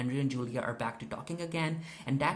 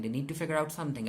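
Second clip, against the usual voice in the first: quick rushed repeat; fast; much faster than usual. Shown as a demonstration. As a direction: rattle it off fast. Repeats line, second time faster.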